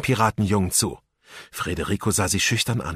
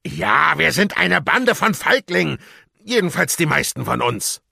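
grumbling captain; 9 semitones higher, 5 dB louder than usual. A narrator's reading. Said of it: "grollte Rotbart".

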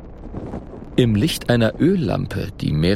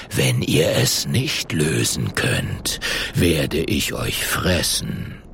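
low, slow, cold snake voice; much lower, slow, unmoved serpent; unmoved menace; 8 semitones lower, slower than usual. A narrator's reading. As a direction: threaten them without feeling. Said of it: "antwortet Meretseger ungerührt".

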